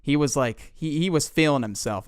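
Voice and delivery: usual speaking voice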